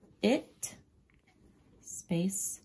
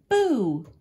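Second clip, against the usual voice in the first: loud, higher Boo; jump-scare Boo; excited shout; 5 semitones higher, 9 dB louder than usual. Retold or imitated. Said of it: "used an excited voice when he shouted".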